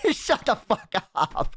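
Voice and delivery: cracking up at a silly joke; laughing disbelief. Real, not acted. Streamer laughing at a chat joke, giggly disbelief.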